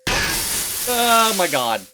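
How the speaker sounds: slow, high, loud oh my god; drawn-out shocked gasp. Real, not acted. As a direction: stretch it out in shock. Jumpscare reaction.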